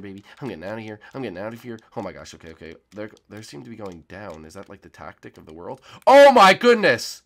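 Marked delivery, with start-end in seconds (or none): 6.1-7.2 loud, high, drawn-out cry of shock; shocked shout. Real, not acted. Player shouting as the map explodes, loud and high. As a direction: yell it as the whole map blows up.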